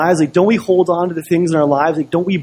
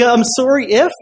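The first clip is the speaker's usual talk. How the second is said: high, mocking rise on IF; incredulous echo; 6 semitones higher than usual. Pastor repeating the other man's apology, incredulous. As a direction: throw IF back as a question.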